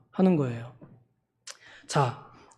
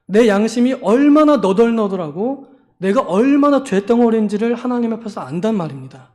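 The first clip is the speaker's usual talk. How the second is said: ordinary talk, nothing marked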